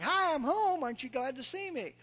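lightly voiced lamb, high and cheery; pet lamb voice. Lamb barges through the screen door, mock cheery. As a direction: play the lamb strolling in, pleased with herself. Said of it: "You know, it's like".

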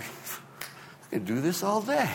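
slow, high, soft mock composure; calm as a cucumber. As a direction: stretch it out lightly, playing unbothered. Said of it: "calm as a cucumber".

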